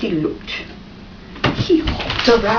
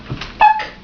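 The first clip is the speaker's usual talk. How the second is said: pluck sound. A narrator's reading. Sound of picking up pebble.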